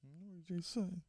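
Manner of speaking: barely audible, tiny answer; dejected child. Acted. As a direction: mumble it very softly, crestfallen. Sad daughter answers again.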